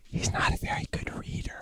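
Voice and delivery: whispered aside; hushed stage whisper. Real, not acted. Whispered aside about a guest.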